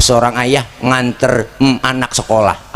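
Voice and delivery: ordinary talk, nothing marked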